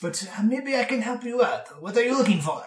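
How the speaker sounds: put-on high, swooping accented gnome voice; loud high peaks, theatrical accent; opinionated old bookkeeper. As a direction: lecture the youngster like a quirky old dwarf. Game master plays old bookkeeper.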